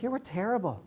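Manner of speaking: blunt friend. Retold or imitated. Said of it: "She was like".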